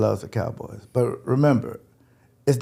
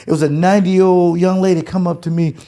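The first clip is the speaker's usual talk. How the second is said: normal talking voice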